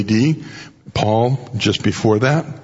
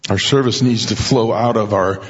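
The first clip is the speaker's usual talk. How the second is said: normal talking voice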